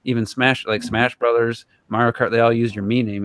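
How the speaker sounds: ordinary delivery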